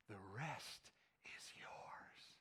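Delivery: slow hushed whisper, sly; slow, low sneaky whisper. Shown as a demonstration. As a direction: whisper it like a tempter in the ear. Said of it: "there could be a little voice".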